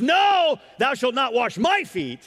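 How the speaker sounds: no shouted very high and loud; Peter's refusal. Acted. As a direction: recoil from the idea. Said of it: "and he says".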